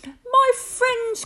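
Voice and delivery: high, urgent elephant's call; crying out. A narrator's reading. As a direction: cry out to friends in concern. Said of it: "cried the elephant".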